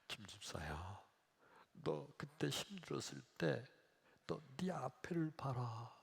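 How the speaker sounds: hushed, gentle divine voice; Jesus. Acted. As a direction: soothe the complainer in near-whisper. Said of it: "그때 예수님 하신 말씀이". (속삭이듯 조용하고 부드럽게)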